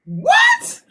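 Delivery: high, stunned yelp of disbelief; shocked. Real, not acted. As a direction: shriek it out in shock. Shocked at Crip visit.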